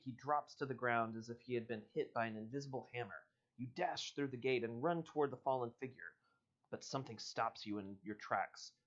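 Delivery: ordinary talk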